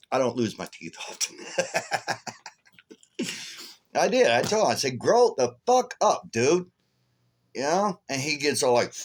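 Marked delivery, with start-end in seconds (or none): 5.0-6.7 high, slow scolding; drawn-out, high-pitched exasperation; retold angry retort. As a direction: relive telling him off, pitch way up. Retells what he told him.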